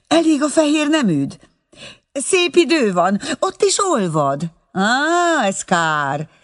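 high-pitched motherly fussing down the line; bossy, fond mother on phone. Acted. (gondoskodó anya magas telefonhangja)

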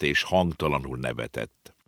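ordinary delivery

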